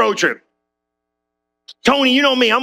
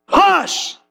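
high, firm hush to the storm; commanding hush; 9 semitones higher than usual. Retold or imitated. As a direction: silence the wind with one word. Jesus rebukes storm.

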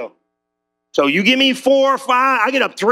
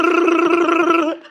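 imitated engine rumble, high; engine noise; 7 semitones higher than usual. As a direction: buzz it like a droning motor. Hears the van engine rumble.

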